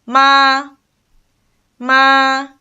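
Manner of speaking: "ma" high, level and sustained; first, flat tone. Shown as a demonstration. Teacher links four tones together, starting with high flat "ma". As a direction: hold "ma" steady on a high pitch. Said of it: "these four tones linked together".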